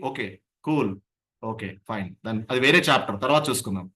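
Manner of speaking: low, easy brush-off; calm letting go. Shown as a demonstration. A trainer showing how to set a remark aside, calm and low. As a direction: shrug it off calmly and move on. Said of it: "conscious efforts పెట్టి దాన్ని పక్కకి జరుపుతారో".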